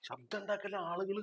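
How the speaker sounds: softly hissed don't make noise; urgent hush. Acted. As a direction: hush them so no one hears. Hushing friend, people will hear.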